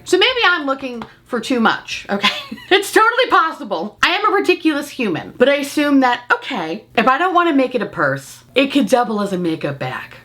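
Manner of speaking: usual speaking voice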